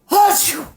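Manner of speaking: loud, high achoo; big sneeze. Acted. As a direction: let out a huge theatrical sneeze. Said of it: "I sneezed a great sneeze".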